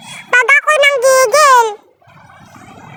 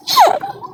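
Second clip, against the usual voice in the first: high burst of laughter; 11 semitones higher than usual. Real, not acted. Laughs after joke.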